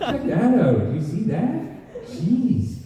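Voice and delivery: high, laughing Toronto onlooker; Toronto accent. Acted. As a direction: exclaim like a startled Toronto local. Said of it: "people with Toronto accents to be reacting".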